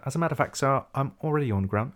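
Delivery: mock English gentleman complying; put-on English accent. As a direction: answer the order in a proper English voice. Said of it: "I'll say it with an English accent".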